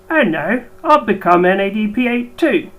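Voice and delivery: hammed-up Cockney groan, pushed out loud; bad Cockney accent. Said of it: "bad impersonation of a Cockney accent".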